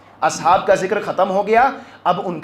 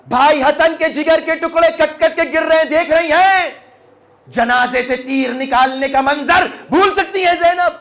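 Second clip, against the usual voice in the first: high, fervent, tearful preaching; impassioned majlis lament; 8 semitones higher, 4 dB louder than usual. Acted. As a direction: cry out the question and answer in grief. Impassioned mourning sermon.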